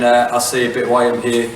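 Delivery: ordinary delivery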